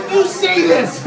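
high, laughing mock demand; gleeful challenge. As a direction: tease him into saying it. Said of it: "and he'd go".

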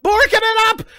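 squeaky high panicky voice; high silly voice. Pretend. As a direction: whine it up high like a cartoon. High silly voice while fumbling.

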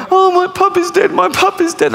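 very high mock-wailing kid; wailing child. Children mourning their dead puppy, high mock wail.

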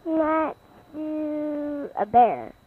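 talking through food; words round a mouthful; mouth full. Real, not acted. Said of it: "got his mouth full of food".

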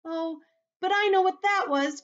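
put-on very high, loud boy's outburst; crying out excitedly. A narrator's reading. James interrupting the centipede's tale, excited cry. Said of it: "cried James".

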